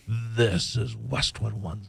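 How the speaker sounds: low, slow growly network ID; growly announcer voice. Retold or imitated. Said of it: "No, no, he goes".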